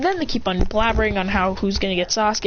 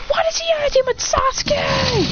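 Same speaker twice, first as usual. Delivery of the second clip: very high whining shriek; high-pitched acted outrage; outraged fangirls. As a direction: squeal the jealous protest. Outraged girls go Super Saiyan.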